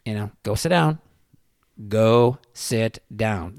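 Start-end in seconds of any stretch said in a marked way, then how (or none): 1.9-3.5 slow, spaced-out "go sit down"; calm, deliberate. Retold or imitated. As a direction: leave a gap after each word in "go sit down". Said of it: "pretty calm".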